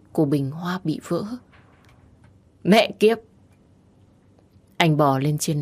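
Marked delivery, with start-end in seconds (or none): 2.6-3.2 higher, louder furious curse; angry curse. A narrator's reading. Husband smashing a vase, furious cursing. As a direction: curse it out in rage.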